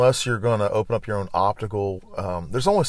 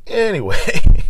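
much louder, higher anyway; excited shout; 12 semitones higher, 12 dB louder, slower than usual. Real, not acted. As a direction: belt it out, laughing after. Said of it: "back at the story at hand".

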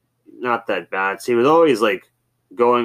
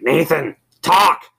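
higher, louder put-on call; mock demand; 8 semitones higher, 7 dB louder, slower than usual. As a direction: prod him to speak up. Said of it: "and so he was like".

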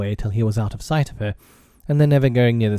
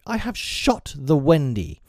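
proud boast; 7 semitones higher than usual. A narrator's reading. Tootles bragging about his shot to the boys, proud. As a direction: savour the boast, pitched high. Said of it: "he cried proudly".

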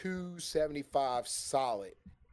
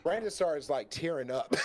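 usual speaking voice

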